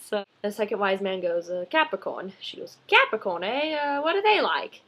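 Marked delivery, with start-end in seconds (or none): 1.5-2.3 lightly voiced British wise man, matter-of-fact; a hint of British accent on a short answer. Second wise man answering Mandy, light British accent. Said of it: "in a British accent". 2.9-4.8 higher-pitched comic British woman's voice; British accent. Retold or imitated. Woman questioning the wise man, high British accent. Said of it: "in a British accent".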